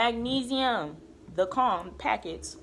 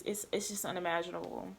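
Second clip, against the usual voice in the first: ordinary delivery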